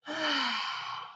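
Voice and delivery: long audible sigh out; big breath out. Shown as a demonstration. Teacher demos a big exhale for children. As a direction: breathe out slowly for the kids. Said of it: "One more big breath".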